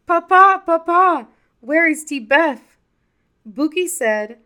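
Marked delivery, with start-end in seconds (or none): 0.1-2.8 very high, loud shouting calls; children shouting. A narrator's reading. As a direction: yell for papa at the top of their voices. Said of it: "came out of the house shouting".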